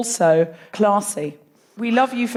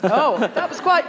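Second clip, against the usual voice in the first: ordinary talk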